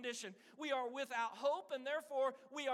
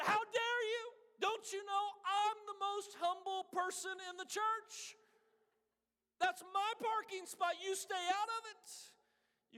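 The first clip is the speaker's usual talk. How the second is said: high, loud, hammed-up outrage; mock indignant outburst; 9 semitones higher, 4 dB louder than usual. Pastor scolding Brother Mark over the parking spot, mock outrage. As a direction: play the offended pastor, puffed up. Preacher acts out his own prideful outburst.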